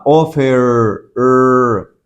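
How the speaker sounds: "offer" stretched slowly, final "er" held and repeated; voiced R ending. Shown as a demonstration. Teacher has students feel the throat, "offer" with a long voiced er. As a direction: hold the "er" of "offer" so it vibrates. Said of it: "si se pone la mano acá".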